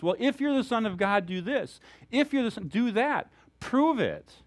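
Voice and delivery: high, pressing challenge as the tempter; taunting dare. Retold or imitated. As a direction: dare him to prove himself. Preacher voices Satan challenging Jesus.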